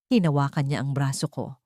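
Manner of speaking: usual speaking voice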